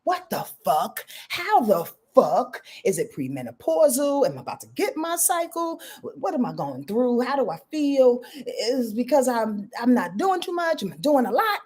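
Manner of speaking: quizzing herself in a panic, pitch climbing; frantic self-questioning. Retold or imitated. Woman retelling waking up confused, anxious questioning. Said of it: "and saying to myself".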